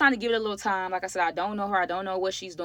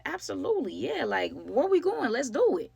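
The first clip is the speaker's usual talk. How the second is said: eager yes; 6 semitones higher than usual. Retold or imitated. Eagerly accepts free food offer.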